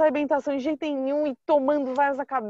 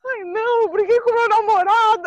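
high and louder, hammed-up distress; put-on sob story; 9 semitones higher, 7 dB louder than usual. She fakes a breakup excuse to the stranger, high mock distress. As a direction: sell the fake excuse dramatically.

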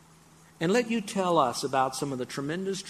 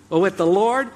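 ordinary delivery

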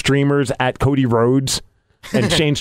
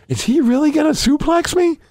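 mock worry; 13 semitones higher than usual. Retells his worry before the bout.

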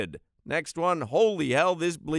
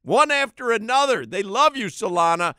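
loud, high hyped shout; excited; 7 semitones higher, 7 dB louder than usual. Real, not acted. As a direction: pump up the crowd. Hyped call to co-host.

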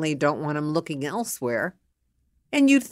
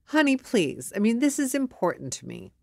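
higher-pitched, earnest plea; pleading wife; 6 semitones higher than usual. Acted. Wife begging husband to talk, pleading. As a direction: beg him to listen.

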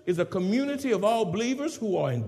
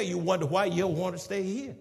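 ordinary talk

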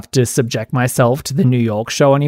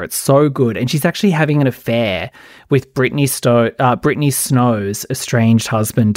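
normal talking voice

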